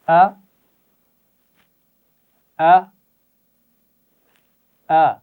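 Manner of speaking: a clean short glottal "آ" from the throat; glottal a sound. Shown as a demonstration. Teacher modelling "آ" for the children, crisp glottal a. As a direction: say "آ" as a crisp glottal stop plus a. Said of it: "غور سے سنیے اور دہرائیے".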